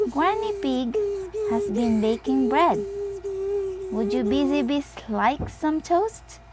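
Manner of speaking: a lightly voiced grandma offering toast; Granny Pig voice. A narrator's reading. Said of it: "she asked".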